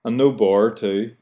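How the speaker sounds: no bor with the th dropped, said slowly; Belfast no bother. Shown as a demonstration. Host teaching listeners Belfast slang, slow no bor. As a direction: drawl no bor, Belfast style. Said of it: "instead of no bother".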